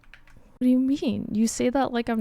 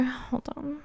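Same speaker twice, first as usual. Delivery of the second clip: usual speaking voice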